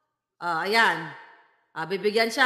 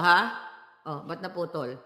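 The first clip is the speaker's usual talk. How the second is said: ordinary talk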